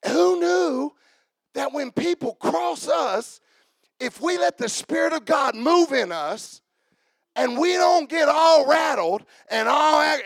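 loud, emphatic preacher's delivery; full-force preaching. Real, not acted. Preacher urging people to let the Spirit move, full-force preaching. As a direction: preach it out with stretched, shouted peaks.

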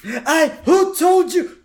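high, slow mock surprised old man; imitating Mr. Burns caught out; Burns quote. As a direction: stretch who in a squeaky Burns voice. Quoting Burns line.

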